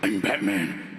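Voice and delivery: very low gravelly "I'm Batman"; Batman growl. Retold or imitated. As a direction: drop into the Batman rasp. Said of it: "Batman's real identity at night".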